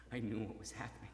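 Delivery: ordinary delivery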